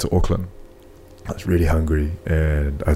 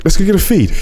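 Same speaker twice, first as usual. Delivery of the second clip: much higher, louder, won't take no; pressing invite; 12 semitones higher, 5 dB louder than usual. Retold or imitated. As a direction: insist again, refusing his refusal. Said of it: "And he's like".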